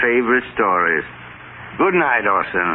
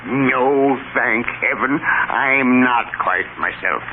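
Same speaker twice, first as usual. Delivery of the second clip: ordinary talk, nothing marked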